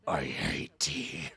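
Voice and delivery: low hushed whisper. Acted. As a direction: whisper it under your breath. Muttered aside while tea is handed out.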